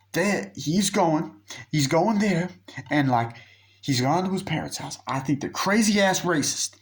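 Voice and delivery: high-pitched, emphatic retelling of an agitated friend; mock worried report. Friend reporting who went to the parents' house, mock-agitated. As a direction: act out his alarm, pitched well up.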